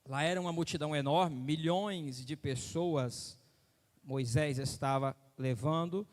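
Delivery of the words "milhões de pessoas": ordinary delivery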